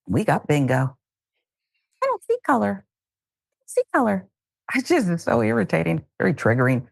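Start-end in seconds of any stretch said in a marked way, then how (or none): 2.0-4.3 mocking imitation. Host parroting the senator's line as bingo, high mocking voice. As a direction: mimic the line in a high put-on voice.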